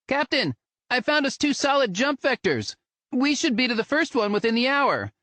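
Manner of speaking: high, bright voice of a crewmate; high-pitched crew voice. Acted. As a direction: pitch it way up for the pilot. Another crew member's voice.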